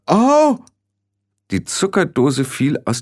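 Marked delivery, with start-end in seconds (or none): 0.1-0.6 plötzliches hohes, lautes Oh; erschrockener Ausruf. Acted. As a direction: vor Schreck kurz aufschreien. Zuckerdose fällt ihr herunter. (jäh erschrocken)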